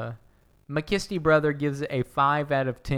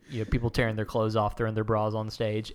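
usual speaking voice

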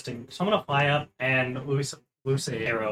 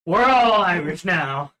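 put-on Irish accent; 4 semitones higher, 8 dB louder, slower than usual. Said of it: "Y'all doing the accents".

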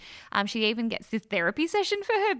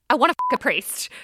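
laughing blurt; 4 semitones higher, 7 dB louder, faster than usual. Retold or imitated. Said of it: "And then she goes".

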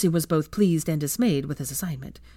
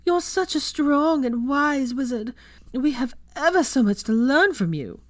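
higher, slow, gushing fake voice; teasing flatterer voice; 9 semitones higher, 4 dB louder than usual. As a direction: fawn over the wizard, hammed-up. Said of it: "Blaise took up the teasing".

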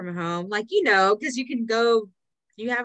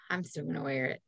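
defiant aside; 6 semitones lower, 9 dB quieter than usual. Retold or imitated. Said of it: "I'm just like".

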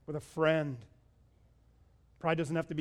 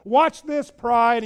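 loud, high, triumphant defiance of pride; defiant preaching at full force; 6 semitones higher, 13 dB louder, much slower than usual. Acted. Preacher voicing a believer telling pride off, loud and defiant. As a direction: shout it down like you mean it.

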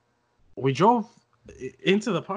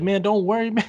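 lightly voiced friend, pitched up; dismissive friend impression; 8 semitones higher, 5 dB louder than usual. Friend waving away the danger, mock-dismissive. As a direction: brush the worry off in a higher imitated voice.

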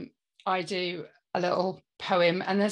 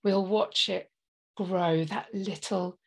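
poem recital. Acted. Improvised heartfelt poem recital.

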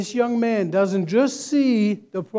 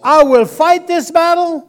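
preaching at full force; 8 semitones higher, 9 dB louder than usual. Acted. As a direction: proclaim it over the church. Call and response.

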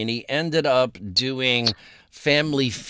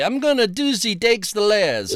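badly faked Cockney, pitched up; higher, hammed-up Cockney attempt; mock Cockney; 6 semitones higher than usual. Shown as a demonstration. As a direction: have a clumsy go at Cockney. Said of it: "the real Cockney thing is".

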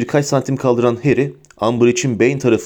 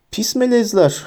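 high-pitched acted insult; shouted; 9 semitones higher than usual. A narrator's reading. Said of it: "diye bağırdı Umbridge". (tiz sesle bağırarak)

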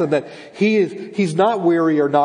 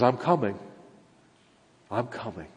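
low, softening reassurance; quiet promise; 5 semitones lower, 7 dB quieter than usual. Acted. God answering whether he will deliver us, quiet promise. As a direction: let the repeat drop softer.

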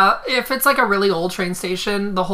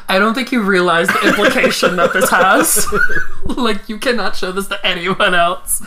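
laughing; 6 dB louder than usual. Retold or imitated. Retelling a shocked warning, laughing.

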